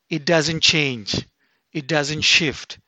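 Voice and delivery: slow, deliberate; steady preacher's assurance; slow conviction. Acted. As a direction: press each phrase home.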